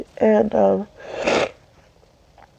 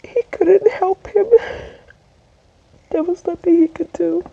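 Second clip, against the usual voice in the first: high, breaking into tears; real helpless crying, pitched up and halting; tearful grief; 8 semitones higher than usual. Real, not acted. Speaker recalls uncle unable to save him, tearful. Grieving, tearful account of death.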